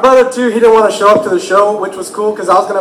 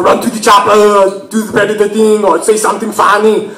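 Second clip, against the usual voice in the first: put-on Austrian accent, heavy vowels; action-hero Austrian accent; Arnold Schwarzenegger. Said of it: "I was doing Arnold Schwarzenegger".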